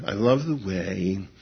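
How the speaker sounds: usual speaking voice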